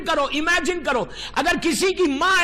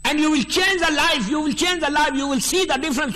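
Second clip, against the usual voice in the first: preaching exhortation; much slower than usual. Acted. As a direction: drive it home to the congregation, slowly.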